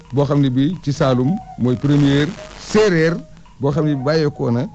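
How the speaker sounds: ordinary talk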